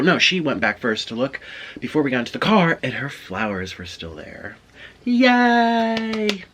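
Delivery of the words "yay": high, drawn-out yay; mock cheer. Celebrating that her flowers were still there, high playful cheer. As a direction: cheer in a long, high note.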